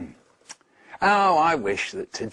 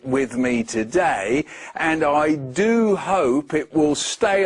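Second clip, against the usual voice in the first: slow, drawn-out sweetly sly wish, rising at the end; sly, deliberate; 3 dB louder than usual. Acted. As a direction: lay it on thick to trick the creature. Nate tricks the opposite, smiling.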